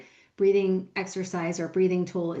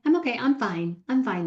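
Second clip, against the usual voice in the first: pretending to be okay, starting high then settling; quick, insistent show of being fine; finta di stare bene; 4 semitones higher, 3 dB louder, much faster than usual. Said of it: "I'm just gonna pretend I'm okay".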